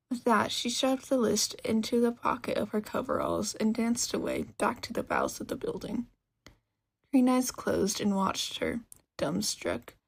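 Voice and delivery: ordinary delivery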